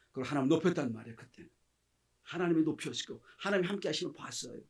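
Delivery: normal talking voice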